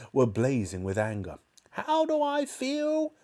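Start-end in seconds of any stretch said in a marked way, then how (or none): 1.7-3.1 very high, slow, outraged yell; grandma yelling. A narrator's reading. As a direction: throw the question back in fury. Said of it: "she yelled".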